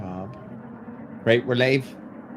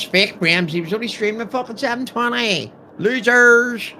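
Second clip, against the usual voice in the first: mocking; 9 semitones higher, 9 dB louder than usual. Mocking the viewers.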